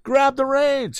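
very high called cry; cried. A narrator's reading. Tom crying out to the young lady, high shout. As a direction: yell it up to the wagon. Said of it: "he cried again".

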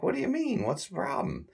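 very high, baffled whine; confused Curly. Retold or imitated. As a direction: squeal it, totally lost. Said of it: "Curly's confused".

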